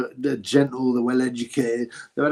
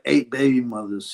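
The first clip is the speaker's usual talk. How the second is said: ordinary talk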